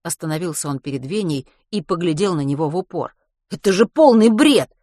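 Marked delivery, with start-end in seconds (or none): 3.5-4.7 indignant. A narrator's reading. Matvey scoffing at theory.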